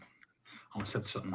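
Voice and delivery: hushed unvoiced aside; whisper. Real, not acted. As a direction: whisper it so nobody hears. Nearly gives away the answer.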